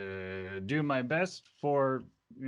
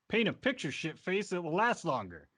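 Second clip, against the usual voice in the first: higher-pitched, lightly mocking jab; mild cheeky retort; 6 semitones higher than usual. Witness to the staring judge, mildly cheeky retort. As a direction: toss out a playground taunt. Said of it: "Harleth Earl looks at him and goes".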